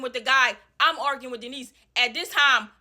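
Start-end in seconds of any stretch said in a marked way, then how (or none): none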